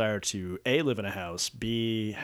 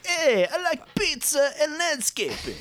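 high, slow hammy New York guy; slow, exaggerated New York wise guy; New York accent; 4 dB louder, slower than usual. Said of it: "the New York accent".

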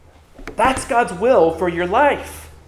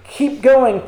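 higher-pitched, urging them on; lifted, affirming exhortation; encouragement; 6 semitones higher, 4 dB louder than usual. Acted. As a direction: cheer them on to keep at it. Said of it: "This is meant to be encouragement".

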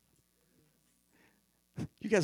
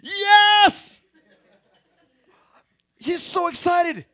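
much higher and louder yell of joy; excited yes; 14 dB louder, much slower than usual. Retold or imitated. Peter hearing he will die for Jesus, yelling with joy. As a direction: burst out in delight. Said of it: "And I think Peter's like".

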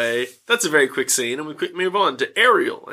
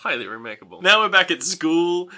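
ordinary talk

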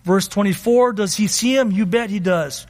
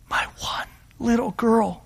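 pleading in a broken-off whisper; soft, whispered entreaty; hushed pleading; 2 semitones higher, 3 dB quieter than usual. Acted. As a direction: whisper it as a desperate plea. Father begging for his dying daughter.

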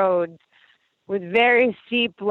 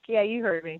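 ordinary talk

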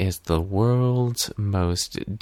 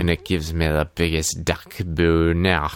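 low, hammed-up Scottish brogue; Scrooge; 5 semitones lower than usual. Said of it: "giving himself a pep talk in the mirror".

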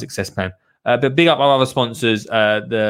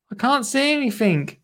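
much higher, high-pitched complaint; muffled child voice; 11 semitones higher than usual. Acted. Dottie with pot on head, high muffled child voice. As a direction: cry it high, as if from under a pot. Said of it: "came a muffled voice".

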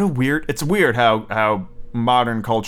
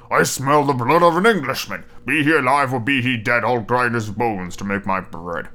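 giant's voice; 2 semitones lower than usual. Acted. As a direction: rumble it out like a menacing giant. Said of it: "The giant cried".

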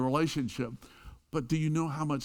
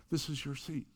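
said softer, just for her; quiet offer; 7 dB quieter than usual. Retold or imitated. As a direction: hand her the seat without fuss. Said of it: "'cause nobody heard that".

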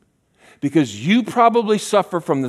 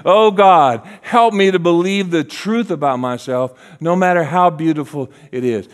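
raised, louder impassioned plea; prayer; 3 semitones higher, 5 dB louder than usual. Retold or imitated. Preacher repeating a prayer for the congregation, raised plea. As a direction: pray it out loud and emphatically. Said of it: "need to hear that again".

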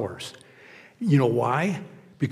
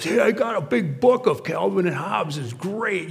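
higher-pitched imitation of a kid; excited kid; 6 semitones higher than usual. Kid telling preacher about his book, imitated excited kid. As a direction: put on an eager youngster's voice. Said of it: "this, this kid who came up to me".

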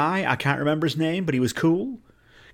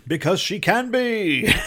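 high, slow, drawn-out answer; hammed-up singsong excuse; mock justification; 6 semitones higher, slower than usual. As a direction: stretch it out in playful mockery. Mock answer to topless-background question.

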